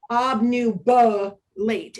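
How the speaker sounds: "obnubilate" slowly broken up, with BA as a drawn-out "buh"; slow down and isolate the "uh" in "obnubilate"; schwa singled out. Shown as a demonstration. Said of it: "it's kind of that uh".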